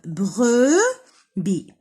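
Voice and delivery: بروبي as "bre", pause, "bi"; syllable by syllable. Shown as a demonstration. Teacher dividing words for children, بروبي broken in two. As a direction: slowly beat out both syllables of بروبي. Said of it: "وهنا عندنا دو سيلاب".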